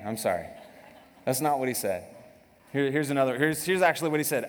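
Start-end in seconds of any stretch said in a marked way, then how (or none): none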